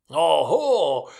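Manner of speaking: más fuerte y agudo; grito alegre. A narrator's reading. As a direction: exclama contento. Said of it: "gritó el anciano Fezziwig". (Fezziwig grita jovial)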